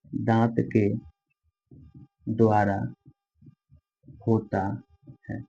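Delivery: word by word with long pauses; slow dictation. Shown as a demonstration. Teacher dictating notes word by word to students. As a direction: dictate each word slowly for writing. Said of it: "word by word dictation".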